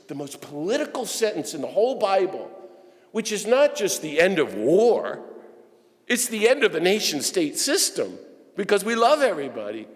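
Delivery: loud, deliberate sermon voice; preaching at full force. Acted. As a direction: press each word on the listeners. Preaching climax.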